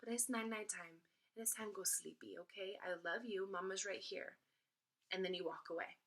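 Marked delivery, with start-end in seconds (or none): 0.0-4.4 put-on soft mama voice for a baby; hushed, tender bedtime reassurance; calm, soothing. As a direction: reassure a fussing baby, softly. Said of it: "just use the calm, soothing tone of your voice".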